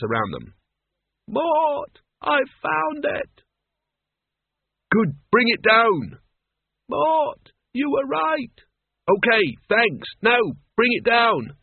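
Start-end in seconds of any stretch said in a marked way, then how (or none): 1.3-3.4 high, slow distant call; calling from far above. Acted. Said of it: "her voice floated down". 4.9-6.2 loud, slow call upward. Acted. Calling up the ladder. 6.9-8.5 distant call. Acted. Calling down from above. 9.1-11.6 loud, slow insistent call; impatient calling up. Acted.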